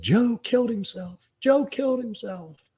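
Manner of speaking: frantic, high-pitched panic; hysterical mother. Retold or imitated. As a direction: relay the news in high, slow, breaking panic. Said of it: "She was fucking hysterical".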